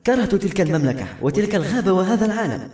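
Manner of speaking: torn, sorrowful. A narrator's reading. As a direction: lament it with restrained anguish. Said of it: "ثم قالت بصوت ممزق حزين".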